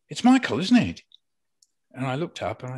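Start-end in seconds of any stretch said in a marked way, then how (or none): 0.1-1.0 voiced high and loud as the passing woman; the delighted stranger who spots him; woman recognising him. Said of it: "she came back again and went".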